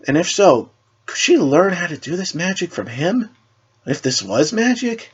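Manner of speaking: put-on high, breathless girlish wonder; Raven's wondering thoughts. Raven privately wondering about the shadow magic, high and awed. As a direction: wonder aloud, full of hope.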